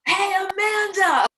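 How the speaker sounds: high, loud street greeting; calling out. Retold or imitated. The neighborhood guys greeting her, high and loud. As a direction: call her name across the street. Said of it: "And they would say".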